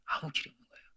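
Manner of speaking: hushed, breathy; whisper. Real, not acted. Preacher whispering to the congregation that there is no way. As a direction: drop to a bare whisper.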